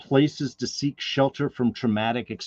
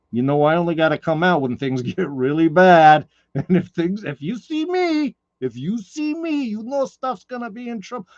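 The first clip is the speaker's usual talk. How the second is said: a hammed-up New York tough guy, higher pitched; loose, jokey New York accent with a laugh; bad New York accent; 6 semitones higher, 6 dB louder than usual. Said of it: "I'm doing my bad New York accent".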